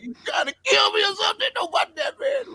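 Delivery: very high, cracking laugh-shout; pained laughing shout. Retold or imitated. Injured friend begging him to stop the jokes, pained laughing shout. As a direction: yelp it out while trying not to laugh. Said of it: "trying not to laugh".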